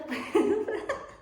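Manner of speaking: short high giggle at herself; a real high giggle; amused laugh. Real, not acted. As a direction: laugh off her own muddle. Laughs at own rambling.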